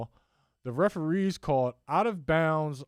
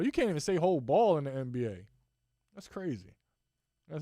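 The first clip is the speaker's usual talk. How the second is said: ordinary talk, nothing marked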